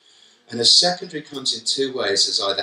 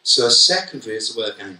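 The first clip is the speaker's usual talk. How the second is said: ordinary talk, nothing marked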